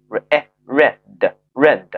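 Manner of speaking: slow re-, 哎, then "read" like red; short E blending. Shown as a demonstration. Teacher showing the past tense of read, short-E blending. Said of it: "它要读作哎哎的音".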